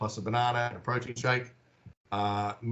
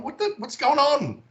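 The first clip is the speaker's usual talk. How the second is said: much higher, startled what's-going-on; a high, bewildered cry; baffled; 4 dB louder than usual. Retold or imitated. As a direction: play confused and caught off guard. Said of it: "I'm going".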